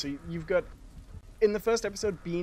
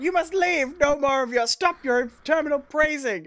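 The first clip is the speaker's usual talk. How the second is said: high, louder hammed-up scolding; mock angry peasant; 10 semitones higher, 6 dB louder than usual. Peasants kicking Elfo out for praising food, mock angry high voice. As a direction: throw the guest out in mock fury.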